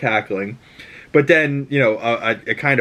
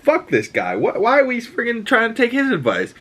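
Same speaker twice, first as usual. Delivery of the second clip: indignant mock protest; 13 semitones higher than usual. Acts out defiant Deathbird's objection.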